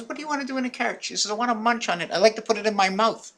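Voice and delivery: lady's voice. Retold or imitated. Lady's lines, voiced high.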